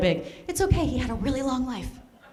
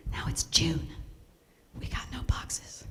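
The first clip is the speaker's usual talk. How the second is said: hushed dramatic whisper; 5 semitones lower, 9 dB quieter, slower than usual. Real, not acted. Confiding to the audience, a dramatic whisper. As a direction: lean in and whisper it. Dramatic aside about no boxes.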